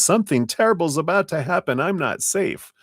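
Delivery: high, fast alarmed voice; mock panic. Tarot reader voicing the fearful thought, mock panic. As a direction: act out the panicked inner voice. Reading the catastrophe trap card.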